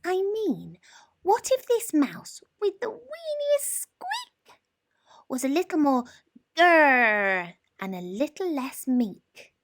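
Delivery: a tiny mouse dreaming big; squeaky little mouse voice. A narrator's reading. Little mouse telling himself he needs a roar.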